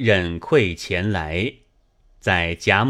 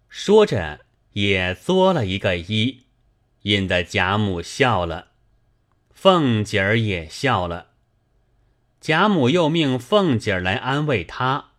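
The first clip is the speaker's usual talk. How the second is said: ordinary delivery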